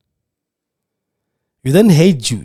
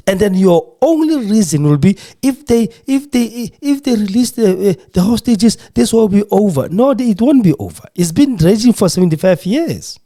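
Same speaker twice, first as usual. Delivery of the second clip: higher, raised indignant voice; passionate outrage; 8 semitones higher than usual. Real, not acted. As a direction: rage against the excuse. Passionate outrage over bombing.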